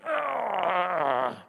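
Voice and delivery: high, loud straining "Argh!"; forced-willpower frustrated roar; frustrated growl. Retold or imitated. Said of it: "were like".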